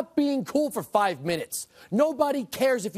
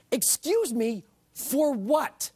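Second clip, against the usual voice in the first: indignant disbelief, pitched up; incredulous; 5 semitones higher, slower than usual. Real, not acted. Commentator challenges the apology to Cairo, incredulous. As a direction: demand an explanation in real disbelief. Outraged reaction to government apology.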